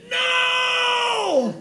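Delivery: high, loud shout of no; a sudden high shouted no; retold yell. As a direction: yell it out in shock. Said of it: "And I yelled".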